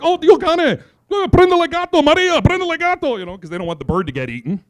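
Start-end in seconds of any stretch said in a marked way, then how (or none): 0.0-3.2 high, loud imitation of Italian parents yelling; mock-frantic shouting in Italian; panicked Italian parents. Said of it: "my Italian parents came running along like".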